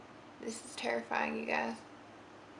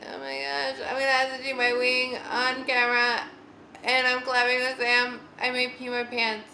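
mock panic, higher and louder; hammed-up worry in a raised voice; retold panic; 4 semitones higher, 7 dB louder than usual. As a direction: act out a mild panic at being on camera. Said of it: "I was panicking".

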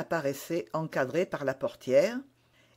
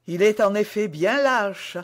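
ordinary delivery, nothing marked

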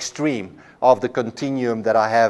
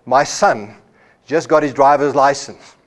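ordinary talk, nothing marked

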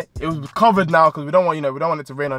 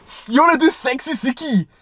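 mock girlfriend voice; 7 semitones higher than usual. Girlfriend teasing him about the act, coy mock voice.

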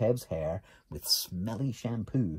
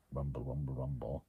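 much lower and softer, rumbling; low rumbling sound effect; 10 semitones lower, 5 dB quieter than usual. A narrator's reading. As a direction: rumble it like a tummy about to blow. Grandad's gases building up.

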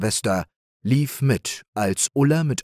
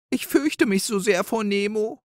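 high, timid little doe whimper; 13 semitones higher than usual. A narrator's reading. Frightened little sister Rike whimpers to Ulla, high and timid. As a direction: whine it out in fear.

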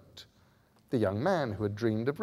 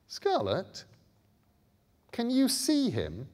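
put-on higher, uncertain boy asking; boy's voice; 13 semitones higher than usual. A narrator's reading. Bod checking with Scarlet, higher and unsure. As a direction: ask her carefully.

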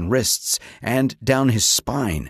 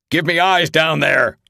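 loud, gruff command; barked order; 5 semitones higher, 4 dB louder than usual. A narrator's reading. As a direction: snap the order at the crew. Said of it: "Ross barked".

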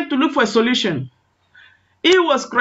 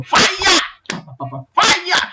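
high shouted prayer command; firing prayer; 7 semitones higher than usual. Retold or imitated. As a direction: call down fire like the praying mother. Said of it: "The mother was firing prayer".

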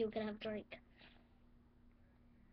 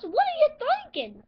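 angry shout; 11 semitones higher, 9 dB louder, faster than usual. Acted. Teacher scolds drink in class.

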